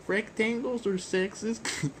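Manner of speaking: high, slow mock woman voice; hammed-up high falsetto imitating a feminist; a hammed-up falsetto lady, drawn out slow. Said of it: "let me sharpen my woman voice".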